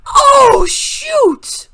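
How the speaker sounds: high, loud alarmed cry; loud high panicked shout. A narrator's reading. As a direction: yelp out in sudden alarm. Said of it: "Melissa shouted".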